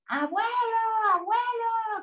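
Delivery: calling out. A narrator's reading. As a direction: call for grandpa in a high voice, no real volume. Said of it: "y le gritó".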